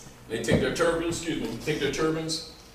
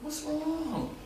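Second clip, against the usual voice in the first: lightly voiced Dot, higher pitch; mock Dot voice; 4 dB quieter than usual. Retelling Oz, Dot asks what's wrong, in a higher mock voice. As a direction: ask it like a worried girl, pitched up. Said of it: "And she said".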